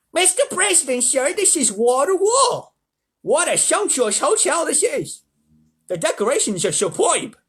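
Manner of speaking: high, loud, drawn-out character voice; hotel manager's put-on voice. A narrator's reading. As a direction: gush slowly as an over-eager manager. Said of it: "What voice should I do for him?".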